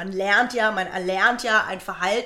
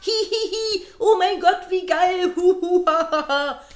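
high, loud mock laughter; gushing fake giggles and hoots; imitated laughing followers; 9 semitones higher, 5 dB louder than usual. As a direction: imitate an amused crowd in falsetto. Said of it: "meine D Ms voll mit Leuten".

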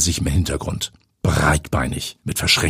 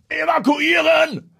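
thundering voice; slower than usual. A narrator's reading. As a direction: boom the evacuation order. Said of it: "ruft ihr Chef mit donnernder Stimme".

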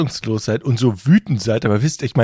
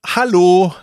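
louder, higher hello; raised, questioning shout for attention; insistent repeated call; 7 semitones higher, 6 dB louder than usual. Retold or imitated. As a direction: call out to someone who hasn't heard you. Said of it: "Er hat's noch nicht gehört".

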